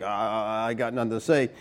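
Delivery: small hushed voice of a chastened Job; sheepish Job. Acted. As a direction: mumble it like a humbled man with nothing left. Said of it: "Job's like".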